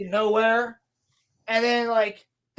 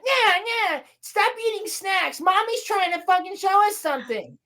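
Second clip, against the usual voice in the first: put-on high, bratty kid voice; whiny kids snapping at each other; the twins bicker over snacks, in high childish voices; squabbling little girls; 9 semitones higher than usual.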